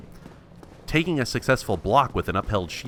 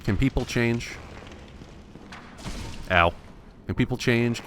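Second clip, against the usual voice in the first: ordinary talk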